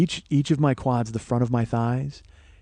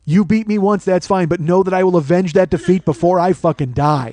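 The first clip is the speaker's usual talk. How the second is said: loud, raised declamation of revenge; mock-heroic vow; 3 semitones higher, 5 dB louder than usual. Vowing to avenge his lost race, loud mock-heroic declaration. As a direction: proclaim it like an avenging hero.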